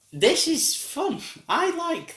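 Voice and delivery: playful high pitch; high sing-song. Acted.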